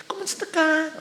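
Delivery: put-on thin girly voice. Teacher imitating a man's voice made thin by steroids. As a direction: lightly imitate a thin, girlish greeting. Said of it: "Yan, iba na".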